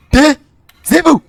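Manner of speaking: slow, high, stretched taisez-vous; drawn-out mock order. Stretching taisez-vous slow and high at the game.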